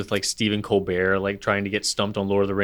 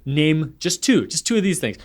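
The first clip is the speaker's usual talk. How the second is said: cocky challenge; 7 semitones higher, 4 dB louder than usual. Retold or imitated. Celebrity guest trying to stump Colbert, cocky. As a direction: toss down the challenge, pitched up. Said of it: "like, cocky".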